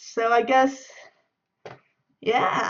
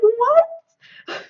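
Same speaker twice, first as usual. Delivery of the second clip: high, loud rising squeak; mock disbelief; 13 semitones higher, 7 dB louder than usual. Passenger reacting to being yelled at, mock disbelief. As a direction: squeal it as a baffled comeback.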